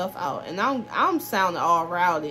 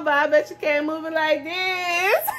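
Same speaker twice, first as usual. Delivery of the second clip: can't hold the laugh while taunting; real laughter breaking in; 7 semitones higher than usual. Real, not acted.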